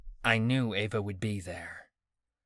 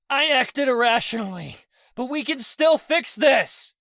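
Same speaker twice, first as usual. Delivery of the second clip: pleading shout; 12 semitones higher, 7 dB louder than usual. A narrator's reading. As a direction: shout it while struggling up. Pleading while getting up.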